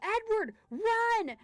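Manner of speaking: high, loud frantic yell; retold scream. Owner screaming at Edward to flee the cow, frantic yell. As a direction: scream the warning like it's happening again. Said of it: "I was screaming my head off".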